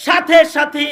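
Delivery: high, loud sermon chant with stretched endings; impassioned high-pitched preacher's cry; full-force preaching. Real, not acted. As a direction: belt it out to the congregation, drawing out key words.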